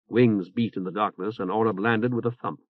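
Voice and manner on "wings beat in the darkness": ordinary delivery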